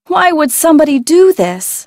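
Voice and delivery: normal talking voice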